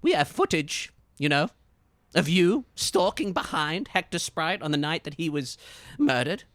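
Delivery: high, loud accusation; detective voice. Acted. Detective confronting a suspect with footage, high and loud. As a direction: confront them with the evidence. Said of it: "he looks at you and he says".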